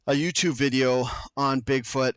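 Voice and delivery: ordinary delivery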